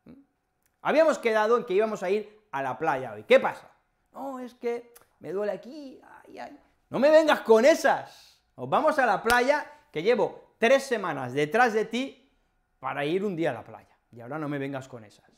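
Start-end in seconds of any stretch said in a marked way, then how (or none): 4.1-6.6 mock whining excuse. Friend fakes pain to skip beach. 6.9-12.3 high and loud, fed-up scolding; mock scolding. To a friend dodging the beach trip, a loud fed-up scolding. 12.8-15.3 rushed end. Acted.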